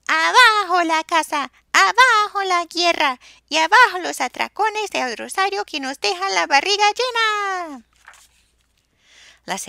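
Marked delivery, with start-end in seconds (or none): 0.1-7.8 high, loud hooted chant; hooting wail. A narrator's reading. Soso hoots his own peaceful version, high and loud. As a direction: hoot it high and long. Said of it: "Pues ululaba".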